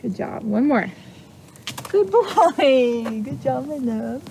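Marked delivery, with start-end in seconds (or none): 1.9-4.2 praise for horse. Real, not acted. Praising horse after exercise.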